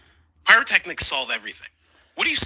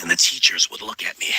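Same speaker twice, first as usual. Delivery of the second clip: ordinary talk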